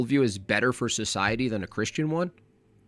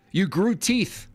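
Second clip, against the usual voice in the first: mock accusation; 6 semitones higher, slower than usual. Mock accusation, absurd example.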